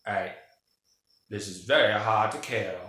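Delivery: put-on accent, dropping the r in "hard"; Stephen Lang. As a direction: act out a movie character's accent. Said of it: "That's my, uh, Stephen Lang accent".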